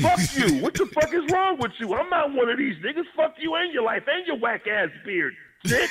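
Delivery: high, heated tirade; going in on him full force; retold rant. Retelling cursing out the guest, high heated tirade. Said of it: "I just went in".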